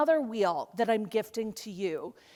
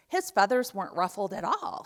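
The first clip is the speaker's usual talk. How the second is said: normal talking voice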